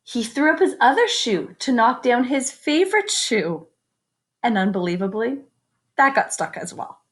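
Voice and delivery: lively picture-book reading; story narration. A narrator's reading. Teacher reading a picture book to the class, lively storytelling. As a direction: read it out to the kids with wonder.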